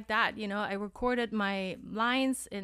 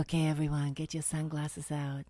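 low sultry announcer voice, lower pitch; failed seductive; 6 semitones lower than usual. Shown as a demonstration. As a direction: purr it low, trying to be sexy. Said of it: "I tried to be sexy on this one".

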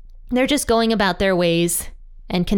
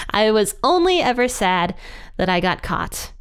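usual speaking voice

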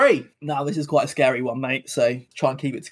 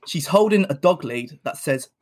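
ordinary delivery

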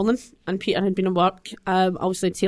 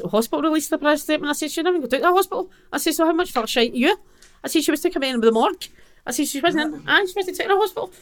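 retold outrage; 8 semitones higher, faster than usual. Retelling her outrage at police.

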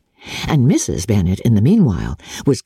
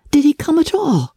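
put-on a touch higher, vexed young woman; lightly voiced Elizabeth; 14 semitones higher than usual. A narrator's reading. Elizabeth puzzling over Darcy's silence, lightly higher and vexed. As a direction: lift the voice a little for a puzzled heroine.

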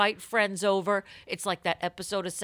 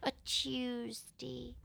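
formal newspaper read; 5 dB quieter than usual. Acted. Formal dateline read of the letter.

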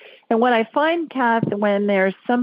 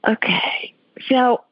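whispering; 4 semitones higher, slower than usual. Real, not acted. Medium listening to spirit.

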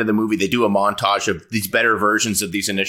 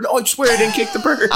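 much higher, squeaky put-on voice of a scared man; mock squeaky denial; 12 semitones higher than usual. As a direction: squeal the denial like a cornered kid. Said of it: "did you kick that bird".

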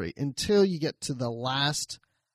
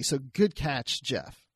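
ordinary delivery

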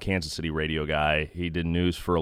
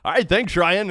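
high, louder, hammed-up imitation of the announcer; mock Denny Matthews; 11 semitones higher, 6 dB louder than usual. Host jokingly imitating Denny's handoff to Ryan, high and hammed-up. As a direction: toss back to Ryan the announcer's way. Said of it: "I so badly wanted to say".

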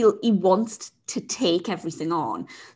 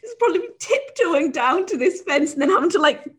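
laughing; 6 semitones higher than usual. Real, not acted. Laughing while describing.

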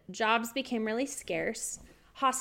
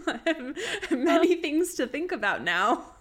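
laughing louder, amused; 6 semitones higher, 4 dB louder than usual. Real, not acted. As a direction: chuckle through it. Laughing after joke.

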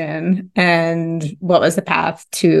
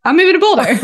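high and loud with a laugh; laughing announcement; 11 semitones higher, 4 dB louder, much faster than usual. Retold or imitated. Telling the host about her own change of heart, high and laughing. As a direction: blurt it out through a laugh. Retelling own sudden decision.

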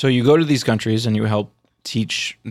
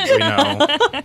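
laughing. Real, not acted. Sarcastic hot take.

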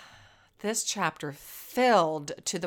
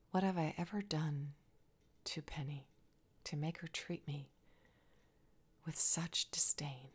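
much softer, hushed pleading whisper; prayer under breath; 11 dB quieter than usual. Acted. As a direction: pour out your pain to God, barely voiced. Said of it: "Hannah prayed under her breath".

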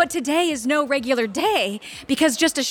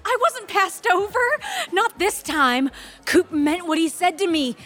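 stunned; 8 semitones higher than usual. Real, not acted. Realizing she was lied to.

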